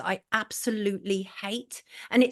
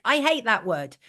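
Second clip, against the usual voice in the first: ordinary talk, nothing marked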